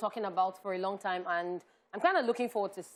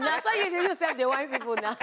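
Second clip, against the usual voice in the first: laughing; 5 semitones higher, faster than usual. Real, not acted.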